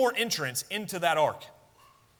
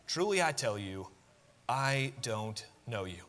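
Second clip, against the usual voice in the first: softer, slower final echo; hushed verdict; 5 semitones lower, 5 dB quieter than usual. Shown as a demonstration. Preacher echoing the master's rejection, soft and heavy. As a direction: let it sink in quietly. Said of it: "repeats the master's verdict".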